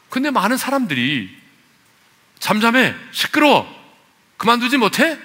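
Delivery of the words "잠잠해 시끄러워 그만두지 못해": high, loud mock rebuke; acted scolding. Preacher acting crowd silencing the beggar, sharp scolding. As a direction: snap at the beggar in short bursts. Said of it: "꾸짖었다는 거죠".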